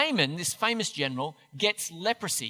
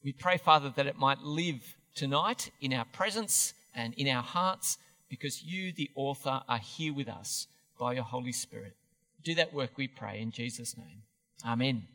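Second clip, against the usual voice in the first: low, softening prayer voice; earnest prayer, dropping low; closing prayer; 4 semitones lower, 4 dB quieter than usual. Real, not acted. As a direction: pray aloud, easing down softly to the amen.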